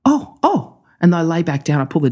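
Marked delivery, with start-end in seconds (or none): 0.0-0.7 imitating mum. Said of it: "she's like".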